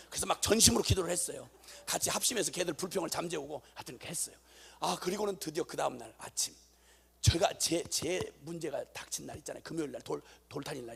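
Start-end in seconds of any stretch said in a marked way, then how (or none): none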